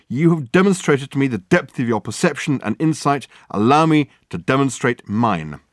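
grandiose detective. Acted. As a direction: declaim it pompously.

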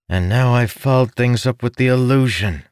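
acted groan of dismay, pitched high; high-pitched, lightly groaned lament. A narrator's reading. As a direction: groan at your own blunder. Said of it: "Ilden groaned".

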